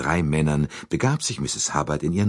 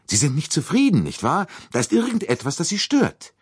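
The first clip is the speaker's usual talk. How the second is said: high, insistent questioning; probing questions; 13 semitones higher, 4 dB louder than usual. Acted. Bouc presses Poirot about what bothers him, high and probing. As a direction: press him, pitch jumping up.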